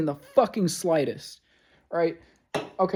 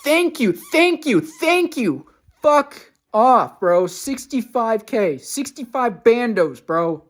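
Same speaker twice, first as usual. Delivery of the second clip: high, loud mocking thank you then fuck off; sarcastic furious thanks; 8 semitones higher, 6 dB louder, slower than usual. Real, not acted. Reacting to the sixty-five thousand dollar quote, high furious sarcasm. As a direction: shriek sarcastic thanks at the price.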